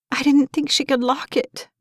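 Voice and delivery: high, anxious voice; worried mother at the locked door; frightened mother. A narrator's reading.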